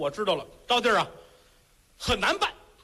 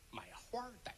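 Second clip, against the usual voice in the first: whispered aside; 20 dB quieter, faster than usual. Acted. The magistrate slyly whispers his fine to the suitor, whispered. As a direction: drop to a whisper for the punchline. Official's sly punchline in court.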